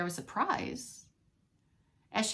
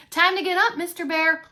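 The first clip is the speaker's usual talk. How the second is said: high, loud wake-up call; calling loudly; 7 semitones higher, 7 dB louder than usual. Acted. Waking sleeping husband.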